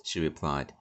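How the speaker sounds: ordinary delivery, nothing marked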